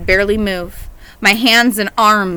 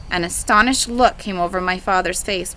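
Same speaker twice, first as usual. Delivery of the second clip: plain reading voice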